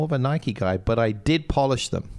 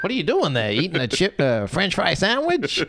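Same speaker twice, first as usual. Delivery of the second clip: higher, hammed-up American accent, puzzled; 7 semitones higher than usual. Teacher mimicking an American colleague, mock American accent. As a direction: play a baffled American in a broad accent. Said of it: "In my strong American accent there".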